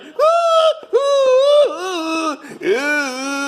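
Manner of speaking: hammed-up rock star squeals; falsetto woo shriek. Prince-style falsetto shrieks.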